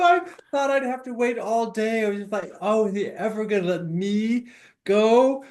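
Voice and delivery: high, hammed-up excited complaint; mock eager impatience. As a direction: whine playfully about waiting for your turn. Mock impatience at waiting turn.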